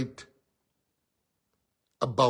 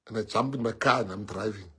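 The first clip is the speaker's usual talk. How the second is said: ordinary talk, nothing marked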